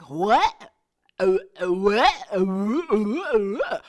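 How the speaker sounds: stunned, stammering what, what; shocked stammer. Retold or imitated. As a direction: sputter in disbelief. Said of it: "I was like".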